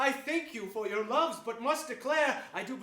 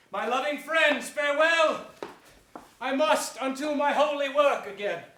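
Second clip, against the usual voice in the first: louder and higher, showy piety; loud pious farewell; 5 semitones higher, 7 dB louder than usual. Acted. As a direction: play the holy man for the crowd. Richard dismisses them loudly.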